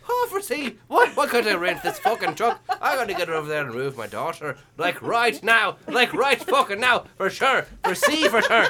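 high, excitable dad caricature; Bill. Acted. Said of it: "her dad is over in the U-Haul place like".

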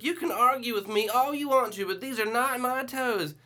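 put-on high, loud stubborn old woman; old lady insisting. Old woman arguing with her daughter, high and insistent. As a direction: dig in like a stubborn grandma. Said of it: "she was like".

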